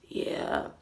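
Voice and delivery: breathy whisper, no voice; just breathe the word out; whispered yeah. Retold or imitated. Deadpan reply to shocked coworkers.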